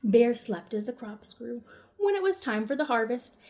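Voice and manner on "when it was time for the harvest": plain reading voice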